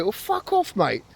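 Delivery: very high mock yell of fuck off; retold telling-off of the moaning stranger; mock retort. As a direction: throw it back at him, retold.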